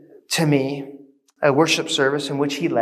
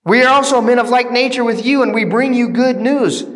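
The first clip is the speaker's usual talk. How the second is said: loud, raised appeal to a crowd; crying out; 6 semitones higher, 5 dB louder than usual. Acted. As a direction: call out urgently, projecting like a preacher. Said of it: "And they cry out".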